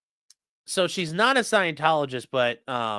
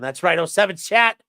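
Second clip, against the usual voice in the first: usual speaking voice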